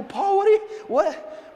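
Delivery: high, loud, sputtering protest; acted bafflement. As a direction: break off in mock disbelief. Acting out baffled protest to Paul.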